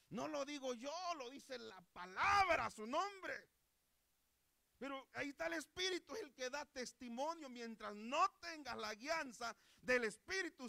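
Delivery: high, loud, driving proclamation; full-force preaching. Real, not acted. Preacher insists it is the Word speaking, forcefully. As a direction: hammer it home to the congregation.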